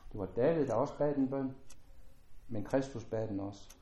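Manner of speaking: ordinary talk, nothing marked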